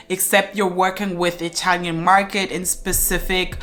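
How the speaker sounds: ordinary talk, nothing marked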